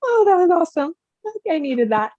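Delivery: tearful. Real, not acted. Client thanking the medium, tearful and delighted. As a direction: laugh through happy tears. Client moved after the reading.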